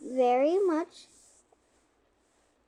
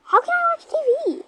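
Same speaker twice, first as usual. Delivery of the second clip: high, fast, loud whine; whiny complaint; 13 semitones higher, 7 dB louder, faster than usual. Pretend. Girl griping about the house, high fast whine. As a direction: whine like a bratty kid.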